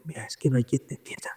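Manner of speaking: softly whispered complaint to God; hushed confiding. Retold or imitated. Man at the tabernacle to the Lord, hushed. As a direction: whisper it in private bafflement.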